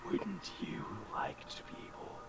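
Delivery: whispered phantom voice with long pauses; sinister spectre, tempting. Acted. Spectre offering power over the unworthy, sinister tempting whisper. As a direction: whisper temptingly, letting each phrase hang.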